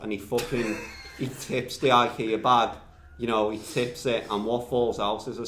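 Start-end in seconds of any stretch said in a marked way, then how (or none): none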